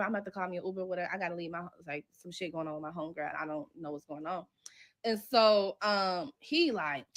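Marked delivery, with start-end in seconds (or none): 0.0-4.4 softer, faked-worried excuse; acted excuse. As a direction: murmur a staged exit line. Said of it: "we are so fucking in character".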